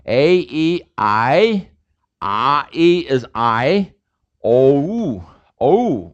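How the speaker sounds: slow, gliding A, E, I, O; English diphthong vowels. Shown as a demonstration. Teacher demonstrating English vowel glides. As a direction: stretch each vowel into a glide. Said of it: "We don't say O".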